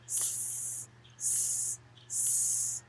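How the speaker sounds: soft drawn-out hissing; quiet breathy snake hiss. A narrator's reading. Snake hisses.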